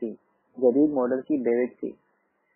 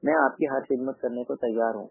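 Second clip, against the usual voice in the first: ordinary delivery, nothing marked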